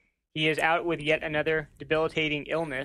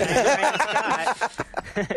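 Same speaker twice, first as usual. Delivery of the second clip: drawn-out slow intro; slow announcer; 5 semitones higher, 4 dB louder, much slower than usual. Pretend. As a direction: say your name slowly and grandly. Deliberately slow mock introduction.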